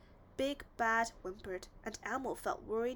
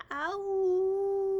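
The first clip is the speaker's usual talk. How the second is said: high, loud drawn-out yowl; wolf yowl; 10 semitones higher, 11 dB louder than usual. A narrator's reading. Wolf in the waiting room: yowl of pain. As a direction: let out a long yowl of pain. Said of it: "Big Bad yowled".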